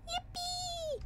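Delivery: high, soft squeaky yippee; squeaky cheer. Real, not acted. Vtuber squeaking yippee at the chat. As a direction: cheer like a cartoon.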